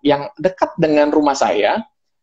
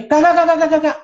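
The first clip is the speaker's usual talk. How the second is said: high rapid-fire repeated syllables; dismissive refusal. Retold or imitated. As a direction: mimic someone waving a customer off. Acted mechanic turning him away. (menolak cepat-cepat dengan nada tinggi)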